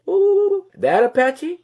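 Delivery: a startled "ooh" as it finally clicks; sudden surprised realization. Retold or imitated. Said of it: "Meaning like".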